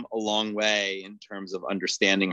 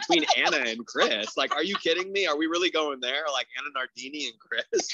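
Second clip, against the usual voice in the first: high, disbelieving are-you-kidding-me; incredulous; 9 semitones higher than usual. Retold or imitated. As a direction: scoff in total disbelief. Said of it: "I was like".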